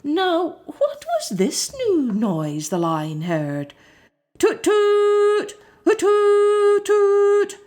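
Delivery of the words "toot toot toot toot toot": high, loud tooting like a horn; trumpet toots. A narrator's reading. Imitating the new noise, high loud tooting. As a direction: toot like a fire-engine horn. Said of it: "went that noise".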